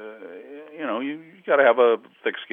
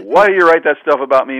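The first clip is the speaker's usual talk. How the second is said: loud, higher mock-angry outburst; a mildly hammed-up angry demand; retold angry yell; 6 semitones higher, 9 dB louder than usual. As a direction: play the furious ballplayer confronting the writer. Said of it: "Candy is all over me like".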